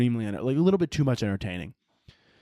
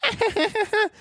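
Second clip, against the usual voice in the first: very high, rapid giggle; imitated kid giggle. Kid leaning back kicking the seat, imitated giggle. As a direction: squeal a string of high giggles.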